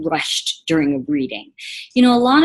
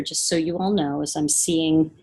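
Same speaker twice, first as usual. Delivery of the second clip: ordinary talk, nothing marked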